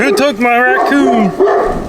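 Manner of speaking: slow, high, whiny dog voice asking for his raccoon; mad dog's voice. Pretend. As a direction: drag it out like an upset pup. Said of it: "that's his mad voice".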